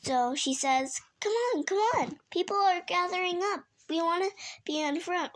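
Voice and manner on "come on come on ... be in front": high-pitched, hurrying little cat; high, excited urging; eager little cat. Acted. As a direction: hurry a friend along in a high, quick voice. Little cat hurrying friend along.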